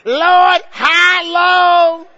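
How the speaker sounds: high, loud drawn-out cry to the Lord; playful imitation of a lamenting churchgoer; church elder wail. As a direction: wail it like an old saint fifty years ago. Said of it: "I heard that same voice in my church".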